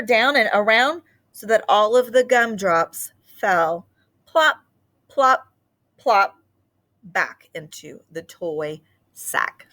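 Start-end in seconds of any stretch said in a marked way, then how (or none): none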